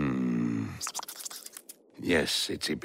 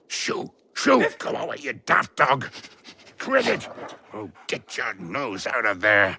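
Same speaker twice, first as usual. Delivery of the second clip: much higher and louder, shooing off a dog; shooing shout; 8 semitones higher, 6 dB louder than usual. Acted. Rock shooing the sniffing dog away, loud and high. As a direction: shoo the dog away, exasperated.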